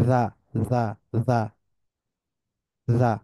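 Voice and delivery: জা with the tongue lightly touching the teeth edge; a voiced dh-like জা; za like soft dh. Shown as a demonstration. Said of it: "কিনারার সাথে touch খায়".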